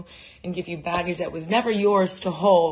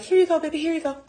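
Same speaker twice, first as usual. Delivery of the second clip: sweet coddling; 10 semitones higher, 3 dB louder, much faster than usual. Retold or imitated. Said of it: "I'm like".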